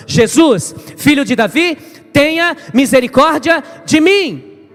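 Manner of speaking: crying out. Retold or imitated. Blind Bartimeu begging Jesus, loud desperate cry. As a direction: call out to Jesus over the crowd. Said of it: "eu vou continuar clamando".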